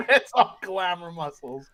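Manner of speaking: slow repeat through laughter; amused, stretched-out mimicry; laughing echo. Repeats the line slowly while laughing.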